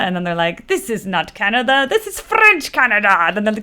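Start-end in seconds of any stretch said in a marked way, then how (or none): 0.7-3.1 mock French Canadian accent, pitch climbing high; haughty cartoon French Canadian accent. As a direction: imitate a cartoon French Canadian, swelling upward. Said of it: "they go into French Canada".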